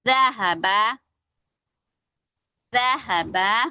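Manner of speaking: ज़हबा with a clear zaal; careful Arabic word. Shown as a demonstration. Teacher reading an Arabic word aloud. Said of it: "एक कोई word ले लेते हैं".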